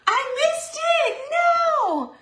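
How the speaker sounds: high, loud cry of dismay; real dismayed shout. Real, not acted. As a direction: yell it out as the moment slips past. Missed the moment, cries out.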